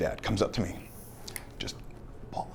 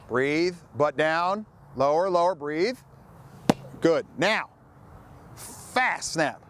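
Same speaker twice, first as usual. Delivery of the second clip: louder, higher coach voice carrying across the field; 10 semitones higher, 5 dB louder than usual. Real, not acted. Coach instructing young long snappers on the field, loud. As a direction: call out drills to the players.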